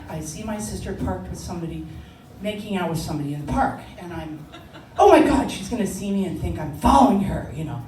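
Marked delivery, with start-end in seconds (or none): 5.0-7.5 loud, high dread; panicked exclamation. Retold or imitated. Teen spotting sister in the park, panicked outburst. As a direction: burst out in mock horror.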